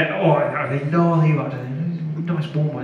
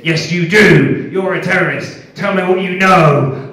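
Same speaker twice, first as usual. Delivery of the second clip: stern, louder interrogator demanding answers; 4 semitones higher, 7 dB louder than usual. Retold or imitated. Interrogator grilling the captive comedian, stern and loud. Interrogator voiced in standup story.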